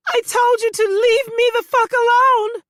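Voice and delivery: high, loud panicked shout. Acted. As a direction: scream it in shock. Continues shouting at stalker.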